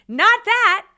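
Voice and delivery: high, very loud exasperated yell; retold scream. Wife about to punch her husband, retold scream. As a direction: scream it back at him in two hard hits. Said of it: "so I scream".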